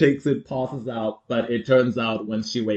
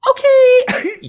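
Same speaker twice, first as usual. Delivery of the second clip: squeaky admission; 6 dB louder than usual. Retold or imitated. Catherine caught out, squeaky.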